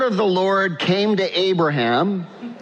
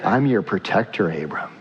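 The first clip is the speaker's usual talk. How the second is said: tender God; 9 semitones lower than usual. Acted. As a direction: speak as a protector calming a child. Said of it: "What does that mean".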